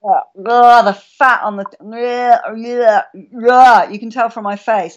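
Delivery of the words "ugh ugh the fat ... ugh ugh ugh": gag it out like the milk is foul; disgusted ugh. Retold or imitated. Recalling the coffee made with the wrong milk, disgusted ughs. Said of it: "and I was like".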